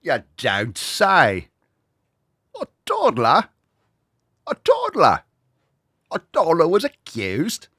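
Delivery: put-on British accent, outraged. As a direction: gasp it out as a scandalised Brit. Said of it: "making fun of the accent".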